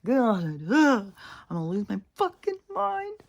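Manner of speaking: very high, strained mock meltdown; exasperated. Walker not hiding frustration with the dogs, high exasperated meltdown. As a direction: squeal it as if at wit's end. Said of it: "I don't hide it well".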